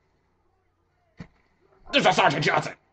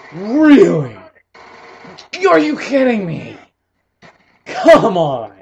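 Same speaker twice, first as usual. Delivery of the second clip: loud, higher exasperated yells; frustrated shouting; 8 semitones higher, 11 dB louder, slower than usual. Real, not acted. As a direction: rage at the game. Frustrated at being killed.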